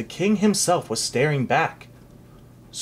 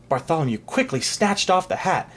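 plain reading voice

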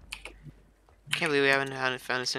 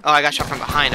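loud frustrated complaint; raging gamer; 2 semitones higher, 11 dB louder, faster than usual. Real, not acted. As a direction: vent it loudly after dying. Complaining after being downed.

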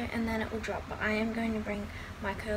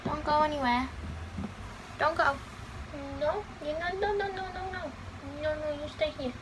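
raised, sing-song plea to a pet; cooing at a pet; 5 semitones higher than usual. Real, not acted. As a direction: coax the little one to stay put. Pleading with pet to stay.

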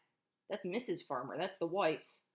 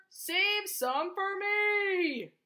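high, long-drawn singsong plea; farmer's cry; 10 semitones higher, 4 dB louder, much slower than usual. A narrator's reading. Farmer begging animals to leave him apples, drawn-out cry. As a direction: stretch every word high, as a storybook farmer calling out. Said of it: "cried the farmer".

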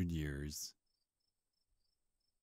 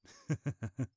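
a bright, high giggle at his own joke; high amused laugh; 8 semitones higher than usual. Acted. Vampire laughing at his own redo, high amused giggle.

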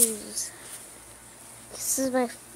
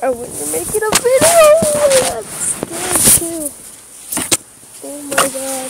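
yelling; 6 semitones higher, 16 dB louder than usual. Real, not acted. Scared yelling in the hammock.

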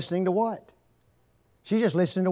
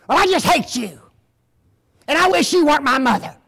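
put-on a child's furious outburst, higher and louder; angry child; 8 semitones higher, 7 dB louder than usual. Child yelling hatred at her mother, furious. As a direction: spit it at mom like a hurt kid.

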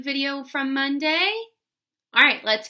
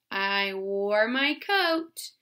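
slow dictation; 3 semitones lower, slower than usual. Shown as a demonstration. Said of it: "Go ahead".

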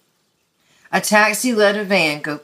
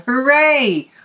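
higher, louder joyful cheer; 7 semitones higher, 4 dB louder than usual. A narrator's reading. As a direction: shout hooray for Blue. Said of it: "clapped their hands and yelled".

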